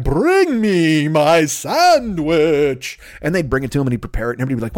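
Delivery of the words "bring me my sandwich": very slow, drawn-out haughty command; pompous lord ordering. Acted. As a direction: stretch every word like a spoiled aristocrat. Earl ordering, drawn-out regal voice.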